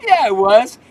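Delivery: loud, high laughing reply. Real, not acted. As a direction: burst out laughing, then agree loudly. Laughs at caller's denial.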